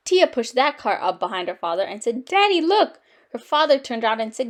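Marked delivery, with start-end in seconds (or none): 2.2-3.0 high, loud excited call to dad; excited child. A narrator's reading. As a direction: call out proudly to get Daddy's attention. Excited child shows off cart.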